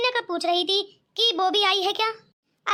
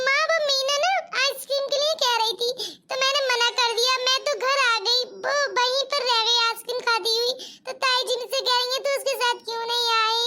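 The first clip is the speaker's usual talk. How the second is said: lightly voiced frightened little girl complaining to mother; a small girl, upset, telling on her aunt; a hint of a tearful little girl; scared child; 3 semitones higher than usual. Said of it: "इतनी डरी हुई क्यों लग रही है?".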